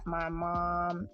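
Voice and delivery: usual speaking voice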